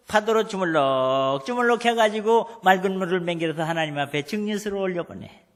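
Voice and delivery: usual speaking voice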